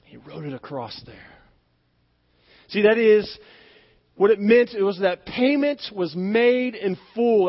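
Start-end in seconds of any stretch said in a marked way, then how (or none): none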